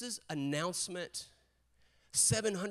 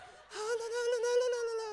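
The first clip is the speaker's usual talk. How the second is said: very high mock-carefree la-la trilling; teasing high-pitched singsong. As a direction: trill la-la-la like someone breezily circling by. Mimicking father-in-law circling on bike.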